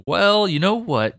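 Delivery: ordinary talk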